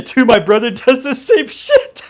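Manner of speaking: high, loud laughing speech; words breaking into laughter. Real, not acted.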